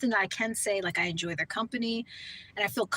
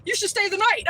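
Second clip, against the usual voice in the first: much higher, louder, eager and delighted; gleeful husband urging her to stay over; imitating excited husband; 11 semitones higher, 5 dB louder than usual. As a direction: play the husband thrilled she's leaving. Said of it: "He gets so happy and excited".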